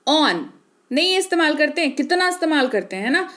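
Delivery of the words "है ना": ordinary talk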